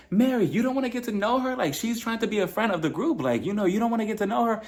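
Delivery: high, incredulous retelling; mock indignant. Voices cast member confronting Mary.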